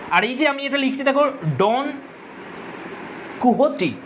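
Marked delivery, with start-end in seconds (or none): 3.4-3.9 কুহটি said koo-ho-tee, falling at the end; correct pronunciation. Shown as a demonstration. Teacher to students, showing how to say the name, careful pronunciation. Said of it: "এটার উচ্চারণ হচ্ছে".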